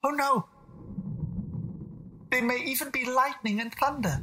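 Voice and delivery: put-on very high, fearful little-boy voice; small boy dreading a storm; horrified child. A narrator's reading. William imagines thunder and lightning, horrified. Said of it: "William's eyes widened in horror".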